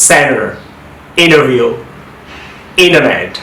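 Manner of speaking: সেন্টার, ইন্টারভিউ, ইন্টারনেট as "senner, innerview, innernet"; American N-only middle in সেন্টার, ইন্টারভিউ, ইন্টারনেট; American dropped T. Shown as a demonstration. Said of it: "এখন আমেরিকানে কীভাবে উচ্চারণটা দেখেন".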